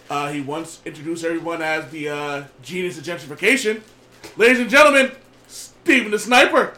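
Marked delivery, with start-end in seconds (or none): 4.4-6.7 ringmaster. Acted. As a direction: hype up the contestant. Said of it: "I am your host".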